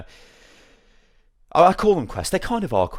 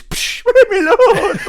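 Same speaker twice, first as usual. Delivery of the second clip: high, loud, drawn-out comic wail; mock scream of pain; 10 dB louder, much slower than usual. Peasant being lashed in the background, mock scream. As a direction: wail as the whip lands, hammed up.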